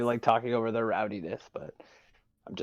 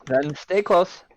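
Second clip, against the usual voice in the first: commanding elf; 7 semitones higher, 5 dB louder, slower than usual. Acted. Elf giving an order.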